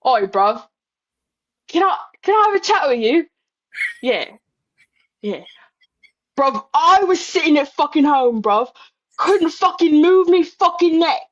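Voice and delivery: put-on British accent, pleading and rising high in disbelief; cockney Ospreay scolding his friend, then pleading; Ospreay impression. Ospreay confronting Swerve about Kenny, in a British accent. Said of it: "can you do an impersonation of what Ospreay".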